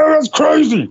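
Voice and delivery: high, exaggerated scoffing; mock dismissive outcry. Mocks a dismissive reaction he avoids.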